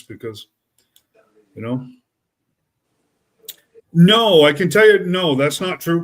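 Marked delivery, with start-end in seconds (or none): 3.9-6.0 loud, higher-pitched firm denial; emphatic disagreement. Real, not acted. Telling Corey he has it wrong, loud and emphatic. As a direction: cut in loudly to contradict.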